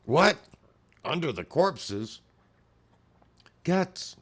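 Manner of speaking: high, loud startled what; shocked what. Real, not acted. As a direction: blurt it out in real shock. Shocked reacting to the page.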